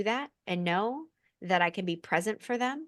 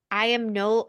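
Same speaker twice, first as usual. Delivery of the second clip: ordinary talk, nothing marked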